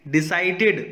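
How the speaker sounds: decided ending in a full extra syllable ID; ending in an ID sound. Shown as a demonstration. Teacher models decided with an ID ending. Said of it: "इड का है".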